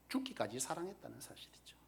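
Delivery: hushed whisper. Real, not acted. Preacher to congregation about God's love, whispered. Tender climax of sermon point.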